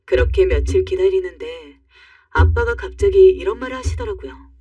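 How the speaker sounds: ordinary delivery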